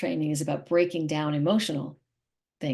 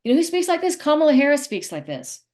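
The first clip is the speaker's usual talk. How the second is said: pinched, tight-throated voice, higher and louder; imitating tight throat; 8 semitones higher, 7 dB louder than usual. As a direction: squeeze the throat to mock a tense speaker. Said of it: "these really tight glottal stops".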